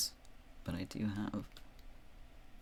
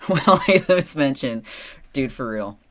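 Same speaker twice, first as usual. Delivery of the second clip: loud laugh into the words; laughing loudly; 5 semitones higher, 11 dB louder than usual. Real, not acted. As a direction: burst out laughing as you talk. Laughing outburst at chat.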